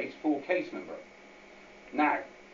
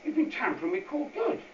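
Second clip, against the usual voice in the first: higher, outraged how dare you; angry retort; 11 semitones higher than usual. Retold or imitated. Client scolds the court clerk for the seal, outraged. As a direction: scold her for tampering, pitch up high. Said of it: "he's really angry".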